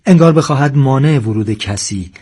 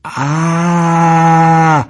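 long, high, loud "aah" scream; drawn-out yell; 6 semitones higher, 5 dB louder than usual. A narrator's reading. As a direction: let out one sustained cry that swells. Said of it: "و فریاد کشید". (فریاد بلند و کشیده)

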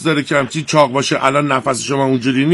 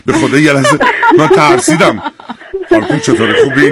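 laughing; 4 dB louder than usual. Real, not acted. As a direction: burst out laughing mid-sentence. Host laughs at serious caller. (با خنده)

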